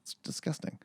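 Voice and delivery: breathy whispered aside. Real, not acted. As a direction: whisper it in disgust.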